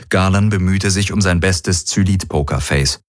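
ordinary delivery

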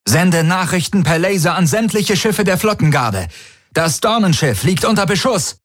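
high, urgent dispatch; calling out orders. A narrator's reading. Officer orders an alert under fire, high urgent call. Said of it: "rief Tari".

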